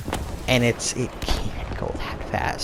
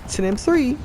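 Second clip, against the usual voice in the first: excited; 12 semitones higher than usual. Real, not acted. Spotting an enemy tank.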